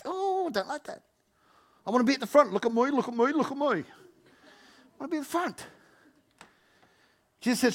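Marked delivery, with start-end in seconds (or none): 0.0-5.7 high, whining me-first voice; whiny child. Retold or imitated. Preacher mimicking a pushy churchgoer wanting the front, whining. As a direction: whine like a kid who wants the front. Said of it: "It's like".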